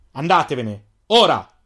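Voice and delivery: shouted. Acted. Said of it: "Urlò Zalen".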